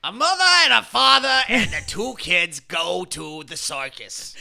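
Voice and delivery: loud, high raspy shouting voice; Gilbert Gottfried. Retold or imitated. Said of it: "my first attempt at doing a Gilbert Gottfried".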